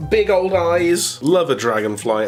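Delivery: normal talking voice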